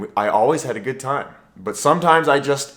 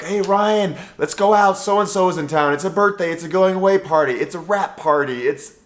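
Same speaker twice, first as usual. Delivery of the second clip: lightly voiced eager friends, higher; a hint of pushy party friends, pitched up; friends' invitation; 6 semitones higher than usual. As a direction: mimic buddies talking him into a night out. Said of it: "It's like".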